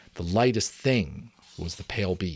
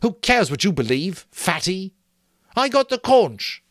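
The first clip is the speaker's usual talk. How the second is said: high, loud, taunting snarl; angry; 11 semitones higher, 6 dB louder than usual. A narrator's reading. Jack to Piggy, angry and loud. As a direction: sneer it at him. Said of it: "unaccountably angry".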